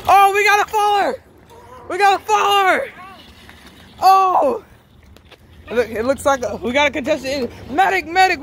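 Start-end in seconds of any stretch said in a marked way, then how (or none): 0.1-4.6 man down shout. Acted. Contestant falls off scooter. 7.8-8.4 shouting for a medic. Acted. Calling for help after fall.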